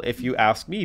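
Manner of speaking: ordinary delivery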